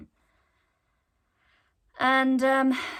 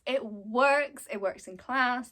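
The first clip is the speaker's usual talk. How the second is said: normal talking voice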